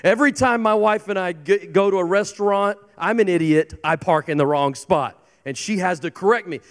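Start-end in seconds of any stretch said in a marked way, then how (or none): none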